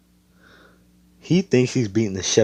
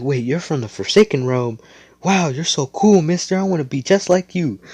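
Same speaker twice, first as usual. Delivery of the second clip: put-on high, bright-eyed kid voice, louder on "wow"; excited kid; 8 semitones higher, 4 dB louder than usual. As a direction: gush like a starstruck little boy. Kid admiring hero, voiced.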